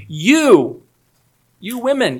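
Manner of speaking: "You" hit hard, then "you women" lifted high; emphatic you. Shown as a demonstration. Preacher showing the angel's emphatic "you" to the women, stressed. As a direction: lean on "you" to single out the women. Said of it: "There's an unnecessary emphatic you".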